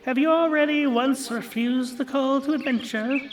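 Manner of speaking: high, strange hag voice prying; crone questioning. Acted. Lachesis quizzing Rune about the call, high crone voice. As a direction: needle him with a witchy question. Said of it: "Asked Lachesis".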